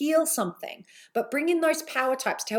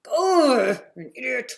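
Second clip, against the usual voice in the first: self-scolding; 3 semitones higher, 4 dB louder than usual. Retold or imitated. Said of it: "if I mess something up and just like".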